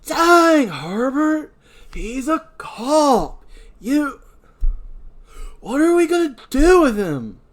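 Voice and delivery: very high, loud, frantic exasperation; panicked outcry. Acted. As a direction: fret loudly at your accomplice. Dan panicking over dead cop.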